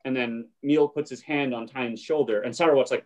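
usual speaking voice

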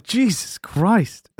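high, drawn-out mock exasperation; exasperated. Reacting to the loud woman.

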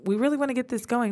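usual speaking voice

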